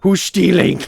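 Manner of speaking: high, loud alarmed call; startled cry of who's stealing; alarmed shout. Retold or imitated. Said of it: "'cause I'm like".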